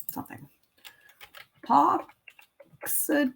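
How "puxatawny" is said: Puxatawny drawn out slowly, syllable by syllable; sounding out. Real, not acted. Crafter working out the groundhog's town name, Puxatawny sounded out slowly. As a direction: say Puxatawny slowly and higher, in separate pieces.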